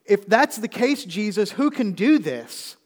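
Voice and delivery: high, loud, slow pleading question; disciples protesting. Acted. Disciples protesting to Jesus, high, loud and slow. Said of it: "now the disciples are saying".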